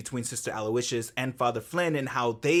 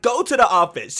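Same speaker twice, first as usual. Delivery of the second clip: raised, louder order to leave, mock-stern; fed-up order; 8 semitones higher, 9 dB louder than usual. Retelling the teacher sending a student out, fed-up and loud. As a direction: snap the student out of class, half-acted. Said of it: "Sister James was tired of that shit".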